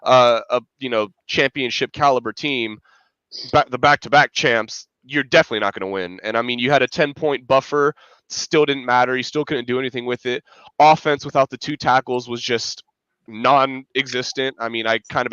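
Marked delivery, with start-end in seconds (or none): none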